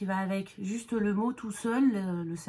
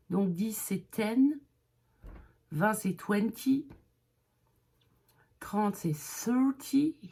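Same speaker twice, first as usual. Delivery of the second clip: slow teaching; slower than usual. Shown as a demonstration. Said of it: "on passe aux dizaines".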